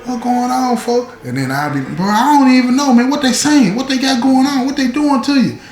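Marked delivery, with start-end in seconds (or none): 0.0-1.1 high called-out yell from a cell; yelling from the hole. Retold or imitated. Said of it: "So he yelling". 1.8-5.6 high, raised calling-out voice; answering a friend in the hole, called out high; calling back. Retold or imitated.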